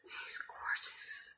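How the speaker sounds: slow whisper, overcome with feeling; emotional whisper. Real, not acted. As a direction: whisper it slowly, deeply moved. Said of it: "Sorry, I'm a little emotional".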